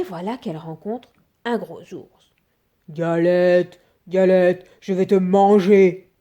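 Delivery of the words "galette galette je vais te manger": much louder growling bear; booming big bear threat; bear's big voice. A narrator's reading. Bear threatening the cake, loud booming bear voice. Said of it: "grogne l'ours de sa grosse voix".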